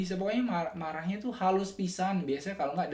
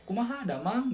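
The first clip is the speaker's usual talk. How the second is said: higher Sundanese greeting, swooping down at the end; Sundanese accent; 7 semitones higher, 3 dB louder than usual. Shown as a demonstration. Repeating the Sundanese greeting for viewers, swooping Sundanese accent. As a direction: swing the Sundanese melody up, then let it fall. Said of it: "yang memang orang Sunda banget".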